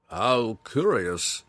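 slow, musing friar voice; a mild wondering remark; curious monk. A narrator's reading. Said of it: "Matthias remarked".

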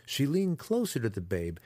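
plain reading voice

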